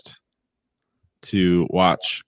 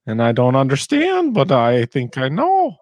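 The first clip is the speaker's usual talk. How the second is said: raised, put-on questioning voice; higher caricature, a little louder; Jordan impression; 6 semitones higher, 4 dB louder than usual. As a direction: mimic his lilting inflection, pitched up. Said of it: "his inflection on his words is just like".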